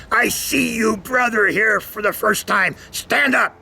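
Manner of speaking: single out a newcomer like a revival preacher; preacher picks him out of the crowd, commanding; preacher calling out. Retold or imitated. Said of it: "he all of a sudden says".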